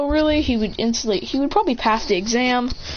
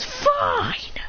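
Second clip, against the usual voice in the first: high, exasperated give-in; grudging concession. Acted. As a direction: give in with a stretched, high word. Bet loser gives in.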